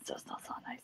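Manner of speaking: whispered aside; just breathe the words; whispering. Real, not acted. Muttered aside at the table.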